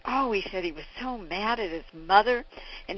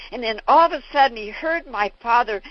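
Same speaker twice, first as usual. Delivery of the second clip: ordinary delivery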